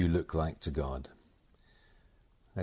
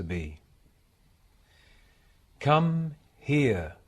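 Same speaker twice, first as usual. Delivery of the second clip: measured devotional recitation, higher and louder than chat; meditation reading; 7 semitones higher, 9 dB louder, slower than usual. Acted. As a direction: read it as a solemn meditation, pausing between phrases. Reads received passage as a meditation.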